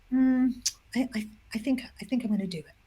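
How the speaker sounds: soft, halting, tentative reply; hesitant agreement. Retold or imitated. CEO agreeing to share his problem, soft and hesitant. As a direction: think it over quietly, then agree.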